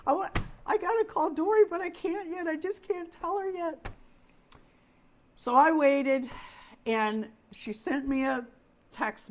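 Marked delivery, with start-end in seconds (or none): 0.1-3.9 high, frantic panic, voice climbing on oh my God; replaying the panic to her husband; panicked pleading. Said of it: "I'm in there telling Darren".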